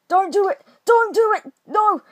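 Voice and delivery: pleading. Real, not acted. Begging an attacker.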